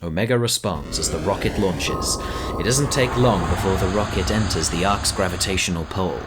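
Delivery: ordinary talk, nothing marked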